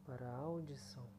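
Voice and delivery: soft, hushed meditation voice; soft spa whisper. Acted. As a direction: lull the listener gently. Leads a guided meditation.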